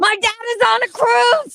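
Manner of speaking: shouted high and loud, hammed up; mock screaming read of the username. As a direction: yell the name like a crazed fan. Screaming the commenter's name.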